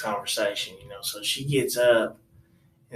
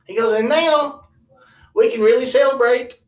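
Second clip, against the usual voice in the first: high and louder, upbeat; cheery uncle; 11 semitones higher, 7 dB louder than usual. Retold or imitated. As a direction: cheer up, time to party.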